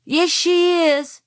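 put-on high, slow, loud child's protest; shouting. A narrator's reading. Boy contradicting his cousin before Grandma, shouting. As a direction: shout back in a drawn-out high whine. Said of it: "shouted Francis".

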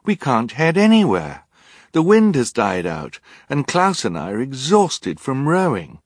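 high, loud objection; exasperated protest. Acted. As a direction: argue back, leaning hard on key words. Violet protests to Olaf.

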